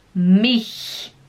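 "mich" with a throaty, scraped ch; ch raspado. Shown as a demonstration. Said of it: "Fala mich". ("mich" com o ch na garganta)

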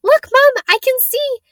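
put-on very high, excited child's squeal. A narrator's reading. Young girl to her mom at the station, squealing. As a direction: squeal to get Mom's attention. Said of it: "she squealed".

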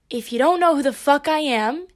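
ordinary talk, nothing marked

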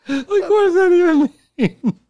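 very high, slow, laugh-choked question; words squeezed out through hysterics; crying with laughter. Real, not acted. Friend asking about the line, laughing hard. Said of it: "high far cry".

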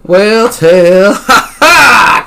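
high, loud, slow battle cry; stretched-out exultant shout; gleeful yell. A narrator's reading. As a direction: yell it with a triumphant laugh. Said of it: "Cassie yelled".

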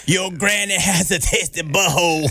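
slow, deadpan trash-talk taunt; lineman trash talk. Retold or imitated. Cohost retelling a bar guy's line-of-scrimmage taunt, slow. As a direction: drag it out like a lineman goading. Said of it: "people were just saying the weirdest shit".

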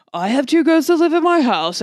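loud, high, eager announcement; excited improv character. Retold or imitated. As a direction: pitch it big and bright. Acting out improv scene.

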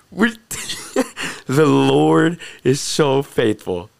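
slow, loud words coming out of a laugh; laugh, then draw it out with real joy; joyful laughing praise. Real, not acted. Speaker praises the Lord's faithfulness, laughing with joy.

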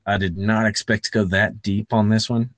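ordinary talk

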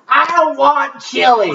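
half-laughing imitation of a cranky old woman's yell; mock scream. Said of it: "And she screams out".

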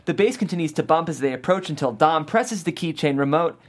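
usual speaking voice

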